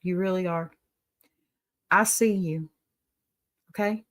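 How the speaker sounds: normal talking voice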